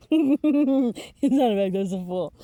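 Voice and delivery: laughs through the words, slow and loud; cracking up at her own mistake; laughing. Real, not acted. Reader laughing at her misread card.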